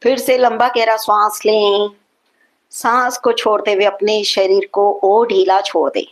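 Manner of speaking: guided meditation. Acted. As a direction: guide them evenly through each long breath. Guides slow breathing and relaxation.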